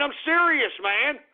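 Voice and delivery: ordinary delivery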